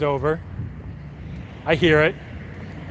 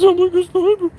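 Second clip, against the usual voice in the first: a much higher, louder squeaky mock of the cop; 14 semitones higher, 5 dB louder than usual. Mocking the cop's sniper report in a high squeak. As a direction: parody the cop's words in a silly high squeak.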